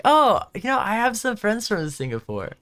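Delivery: high, eager customer-chat voice; bright eager quote. Retold or imitated. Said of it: "I was like".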